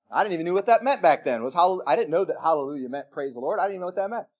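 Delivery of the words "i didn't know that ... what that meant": ordinary talk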